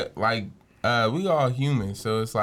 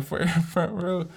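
ordinary talk

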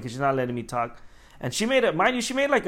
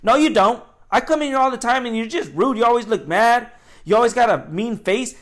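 loud, higher-pitched customer having a go; retold angry accusation; 7 semitones higher, 7 dB louder than usual. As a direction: imitate an irate customer, loud and insistent. Retold customer confronts him loudly.

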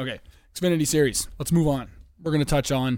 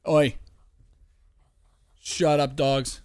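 louder, sharp call to the dogs; scolding the dogs; 2 semitones higher, 5 dB louder, much slower than usual. Real, not acted. To his pugs during recording, loud scolding. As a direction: tell the dogs off across the room.

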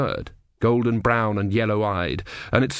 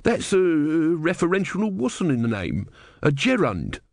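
put-on elderly man; 6 semitones higher, slower than usual. A narrator's reading. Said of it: "said the elderly man".

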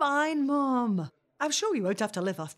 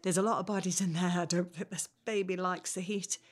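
ordinary talk

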